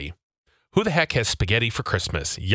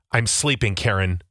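normal talking voice

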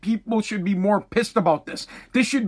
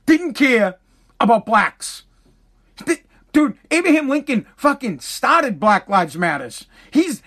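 ordinary delivery

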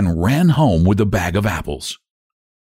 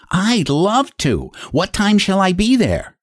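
little pig voice; 9 semitones higher than usual. A narrator's reading. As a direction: accept sweetly like a piglet. Pig accepts invitation.

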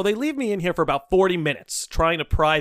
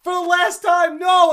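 high, loud exasperated yell; retold defiant shout; shouted refusal; 11 semitones higher, 6 dB louder, slower than usual. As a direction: yell back in a heated standoff.

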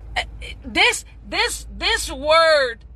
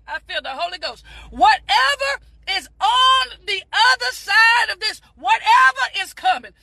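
fervent preaching; 3 semitones higher than usual. Real, not acted. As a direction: preach it to yourself like Sunday service. Preaching self-encouragement at full force.

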